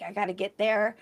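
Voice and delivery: ordinary delivery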